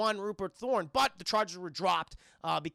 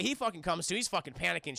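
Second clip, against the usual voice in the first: normal talking voice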